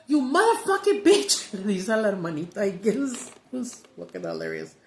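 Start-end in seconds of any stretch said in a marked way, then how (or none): 0.1-1.5 high, loud imitated kid yelling; cussing little girl. Retelling the little girl cussing at mom, high loud yell. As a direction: belt out the swear like a gleeful kid. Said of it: "and she goes".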